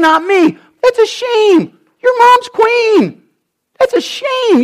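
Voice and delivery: high, loud, near-tearful jealous king; raging King Saul. Acted. Saul accusing Jonathan of wanting David as king, near tears. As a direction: wail it like a wounded jealous king.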